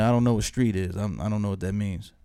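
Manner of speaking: low, innocent not-understanding; playing dumb. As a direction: play clueless, voice kept down. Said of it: "So I, I played dumb".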